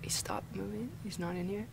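barely audible, breathless whisper; whisper, afraid to be heard; nervous whisper. Real, not acted. Peeking after monster leaves.

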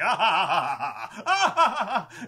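long gloating cackle climbing high; huge acted evil laughter; villain's cackle. Villain cackles ripping out heart.

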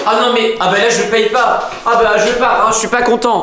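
crie son mécontentement, plus aigu; fâché. Pretend. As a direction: pars en râlant fort. Client furieux part sans payer. (client fâché qui refuse de payer)

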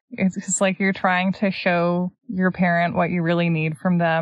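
ordinary talk, nothing marked

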